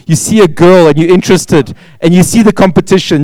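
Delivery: ordinary talk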